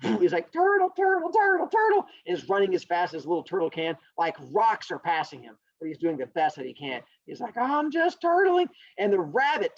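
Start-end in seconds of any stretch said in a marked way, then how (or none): 0.5-2.0 high-pitched, louder chugging chant; running turtle. Acted. 7.6-8.7 high, slow turtle voice; turtle character. Acted. Turtle running in story.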